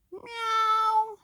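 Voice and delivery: high squeaky meow; cat meow. Acted. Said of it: "said the cat".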